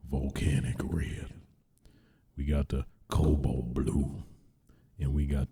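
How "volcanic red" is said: slowed, low dramatic announcement; dramatic reveal. Pretend. Presenting console colors to viewers, slow dramatic reveal. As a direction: savor the color name like an ad. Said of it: "I gotta do it".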